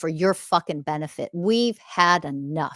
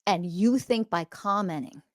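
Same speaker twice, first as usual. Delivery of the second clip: ordinary talk, nothing marked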